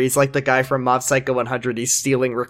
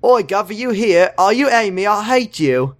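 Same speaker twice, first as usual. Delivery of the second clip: high-pitched mock Cockney quote; 6 semitones higher than usual. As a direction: put on a cartoonish Cockney accent. Mock British street greeting in recap.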